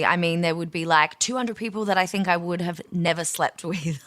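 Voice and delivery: normal talking voice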